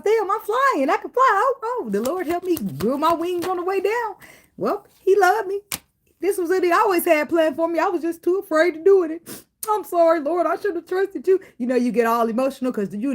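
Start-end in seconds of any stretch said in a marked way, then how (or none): 0.0-4.2 high excited realization; high-pitched amazed delight; amazed joy. Retold or imitated. Voicing person realizing they fly. 4.6-9.4 high excited realization; high-pitched amazed delight; amazed joy. Retold or imitated. Continuing voiced realization. 9.7-11.4 apology to the Lord. Retold or imitated.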